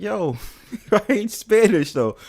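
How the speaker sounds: mock Spanish accent with a laugh; Spanish accent for a troll, slowed down. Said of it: "trolled somebody with a Spanish accent".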